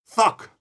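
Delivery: "Fuck" said as thuck, with a th; th instead of f. Shown as a demonstration. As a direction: swap the f in "Fuck" for a th. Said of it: "So it's harder to say".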